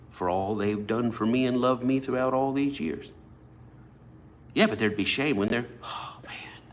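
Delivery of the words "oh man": under the breath, to yourself; whispered aside. Real, not acted. Preacher recalling the shame, a whispered sigh.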